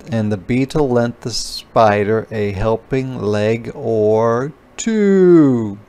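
ordinary delivery

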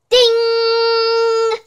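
high, long-held ringing note; imitated oven ding. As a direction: sing out a sustained timer bell. Imitates the food-ready bell.

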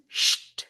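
শিট as bare "sht", no vowel; sound demo. Shown as a demonstration. Teacher demonstrates the cluster, শিট as isolated "sht". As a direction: hiss "sh", close with "t" for শিট. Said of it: "এরকম উচ্চারণ হয়".

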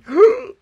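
loud, high theatrical gasp; exaggerated scandalised gasp; mock-shocked gasp. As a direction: gasp as if a secret slipped. Mock shock at own slip.

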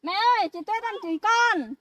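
calling out. Acted. Maid calls out tattling to mother.

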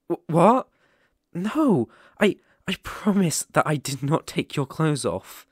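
startled, loud "what?" then hurried protest; flustered denial. Acted. Protesting loudly to the stranger who suspects him. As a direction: caught off guard and rushing to deny it. Accused of undressing listener.

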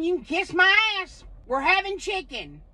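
ordinary talk

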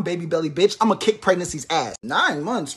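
ordinary talk, nothing marked

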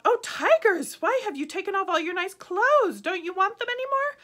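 high, loud teasing call. A narrator's reading. Boy calling to the fighting tigers, high and loud. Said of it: "called out".

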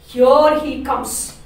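slow and loud, mocking his arrival; mock announcing. Laura sneering at Gonzalo's arrival, slow and loud. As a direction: drag it out, loud and scornful. Teacher reads Laura's line.

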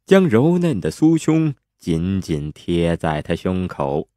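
ordinary delivery, nothing marked

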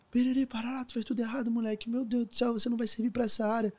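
high mock scolding father voice; squeaky imitation of a nagging dad; mocking angry dad. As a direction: son mocks his scolding father.

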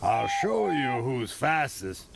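put-on slow old engine's boast; lightly voiced, slow engine character; Freddy tooting. A narrator's reading. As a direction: draw out the challenge slowly. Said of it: "tooted Freddy".

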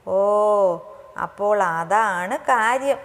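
playful, emphatic "so that's it"; teasing. A narrator's reading. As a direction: draw it out as if catching her secret. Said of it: "തന്നെ കളിയാക്കുകയാണെന്ന്".

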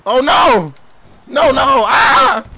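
loud, high-pitched cries of alarm; panicked shouting. Pretend. Gamer watching his wrestler get attacked, panicked shouting. Game character getting beaten.

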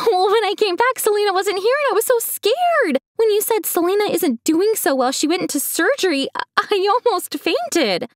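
high, gleeful retelling of his own panic; proud dad reliving his fake panic. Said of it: "My dad sounded so proud".

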